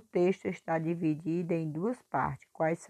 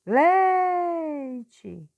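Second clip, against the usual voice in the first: "Leite" with LEI held long, high and loud; exaggerated stretched stress on LEI in "Leite"; stressed syllable; 10 semitones higher, 7 dB louder than usual. Shown as a demonstration. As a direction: belt out the first syllable of "Leite", then drop away. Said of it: "Essa que eu pronuncio mais força".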